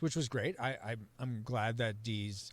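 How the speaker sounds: usual speaking voice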